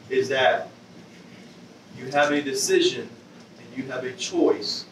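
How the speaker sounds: normal talking voice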